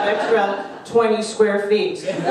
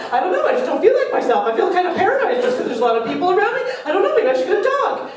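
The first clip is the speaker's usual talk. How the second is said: higher and fast, fretful rambling; anxious city dweller; 8 semitones higher, much faster than usual. Retold or imitated. Comic voicing a cooped-up New Yorker, fast fretful whine. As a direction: babble it like a restless New Yorker. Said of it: "being like".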